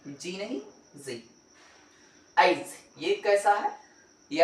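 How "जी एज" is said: normal talking voice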